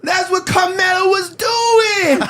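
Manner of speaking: very high, drawn-out wailing protest; high-pitched mock shouting, words stretched out; mock outraged wail. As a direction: imitate an indignant, shouting complainer at the top of your range. Mimicking clip's outraged caller.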